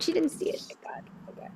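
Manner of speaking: unvoiced whisper of relief; whispered aside. Real, not acted. Host whispering relief that the player missed the answer. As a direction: whisper it so she won't hear.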